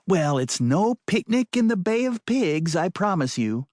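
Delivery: high, sarcastic woman's voice. Acted. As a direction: say it with dry sarcasm. Maddie sarcastic.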